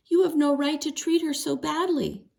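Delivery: put-on angry girl. A narrator's reading. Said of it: "but she was angry".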